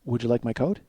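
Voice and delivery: hushed, deadpan offer leaned in close; quiet offer. Retold or imitated. Leaning over to the girl in shorts, offering his coat, hushed and deadpan. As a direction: murmur it politely right by her ear.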